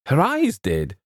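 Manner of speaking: startled, high-pitched query; incredulous echo. A narrator's reading. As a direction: throw the words back in disbelief. James echoes the answer, questioning.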